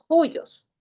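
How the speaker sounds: "juyos" starting with a Spanish jota sound; rough breathing J. Shown as a demonstration. Teacher shows students the rough breathing in juyos. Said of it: "se pronuncia como J".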